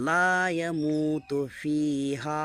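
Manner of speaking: slow recitation, long vowels; recitation to repeat. Shown as a demonstration. Teacher reciting for students to repeat after him. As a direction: recite slowly for the class to echo. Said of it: "practice করেন আমার সাথে সাথে".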